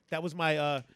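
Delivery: normal talking voice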